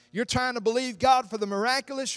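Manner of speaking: ordinary talk, nothing marked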